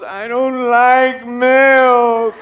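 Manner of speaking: high, long drawn-out whine; whining wife impression. Wife refusing husband's glass of milk, mock whining. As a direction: stretch the refusal out slowly.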